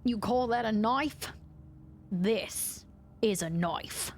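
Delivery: hammed-up Australian movie line; Australian accent. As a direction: attempt an Australian accent, slowing on the comeback. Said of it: "Can you do an Australian accent?".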